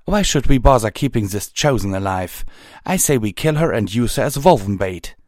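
high, loud, hostile outburst; angry shout. A narrator's reading. Unicorn argues for killing the Chosen, angrily shouting. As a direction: shout your objection to sparing her. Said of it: "the unicorn behind him shouted".

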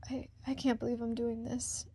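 soft, ashamed whisper to herself. Acted. Woman whispering to herself in shame. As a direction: murmur it in disbelief.

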